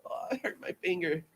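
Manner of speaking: soft, far-off little whine of a dainty lady; mock dainty woman. Host mimicking a delicate woman with a hurt finger, softly whining. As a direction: play a fragile woman fussing over a tiny hurt.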